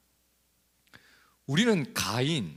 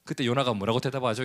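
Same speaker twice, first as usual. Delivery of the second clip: ordinary talk, nothing marked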